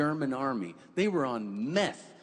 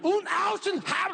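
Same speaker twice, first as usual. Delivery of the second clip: mock German gibberish; 3 dB louder than usual. Mock German overheard in cabin.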